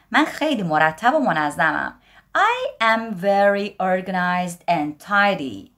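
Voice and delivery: ordinary delivery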